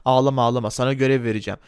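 low, bossy shopkeeper voice; gruff shopkeeper. Acted. Shopkeeper Erdal Bakkal teasing the customer, low gruff voice. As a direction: play the pushy corner-shop owner, pitched low.

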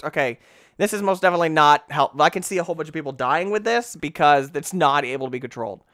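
normal talking voice

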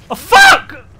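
high, very loud outburst of frustration; voice-straining scream. Real, not acted. Streamer raging at the game, straining scream. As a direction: scream the curse till the voice suffers. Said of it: "My voice".

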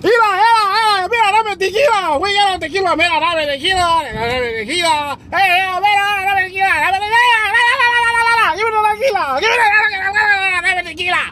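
high, loud mock party yelling in bad Spanish; frenzied, high-pitched fake fiesta cries; whooping drunken Americans. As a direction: holler wildly for tequila. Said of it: "all the Americans are running around like".

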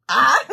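loud burst into laughing; shriek of laughter. Real, not acted. Cracking up at her own joke, loud laughing shriek. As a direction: let a loud laugh explode out.

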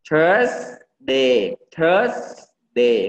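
"Thursday" with a long hissed s before day; THURSSS... day; stressed S sound. Shown as a demonstration. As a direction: hold the S in "Thursday", then add day. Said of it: "ออกเสียง S ให้ถูก".

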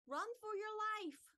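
high, loud alarm cry; panicked shout. A narrator's reading. Boys flee the aliens at their tent, high shout.

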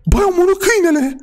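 high-pitched, shocked exclamation; horrified outcry. Acted. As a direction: cry out in disbelief and horror. Horrified that she killed the dog.

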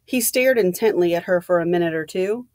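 ordinary delivery, nothing marked

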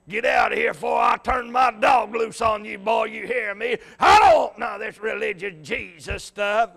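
shouted threat in a put-on voice; angry old fella. Old fella at his door threatens the visitor, shouting angrily. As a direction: run the preacher off the porch. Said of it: "Another old fella come to the door".

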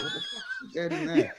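very high, laughing what the; bewildered partygoer, half laughing; mock shock. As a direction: gasp it out through laughter.

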